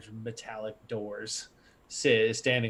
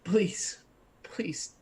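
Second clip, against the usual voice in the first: pleading in a whisper; begging whisper; 8 semitones higher than usual. Acted. GM voicing a begging program, in a whisper. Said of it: "a voice begging".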